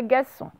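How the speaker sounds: gasson said with no R sound, gas-son; wrong gasson without R. Shown as a demonstration. Teacher showing pupils the mistake: gasson without R. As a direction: drop the R in gasson to show the mistake. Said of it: "ce n'est pas gasson".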